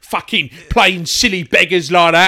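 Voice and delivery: hammed-up Cockney hard man laying into him; mock Cockney geezer rant. As James Caan berating the hungover actor, mock Cockney fury.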